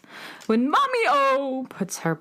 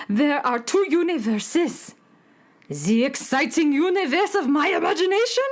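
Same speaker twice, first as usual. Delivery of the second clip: higher, louder and fast; thrilled burst of imagination; excited; 6 semitones higher, 3 dB louder than usual. Acted. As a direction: rush it with wonder. Dramatic monologue, contrasting worlds.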